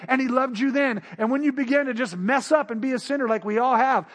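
ordinary delivery

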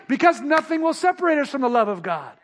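normal talking voice

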